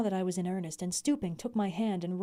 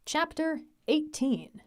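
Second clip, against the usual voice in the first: chapter announcement; 9 semitones higher, much slower than usual. A narrator's reading.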